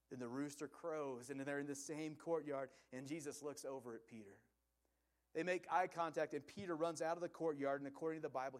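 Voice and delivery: ordinary talk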